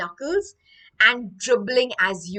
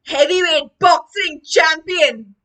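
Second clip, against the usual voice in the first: loud, raised ring-announcer shout; booming champion title; 6 semitones higher, 10 dB louder than usual. Acted. Teacher announcing the baby as champion, booming shout. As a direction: boom out the title.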